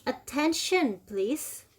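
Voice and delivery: slow, clear drill phrases for students to repeat; teacher model reading. Shown as a demonstration. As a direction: model each phrase slowly for the class to copy. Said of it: "Oke, one more time dari atas".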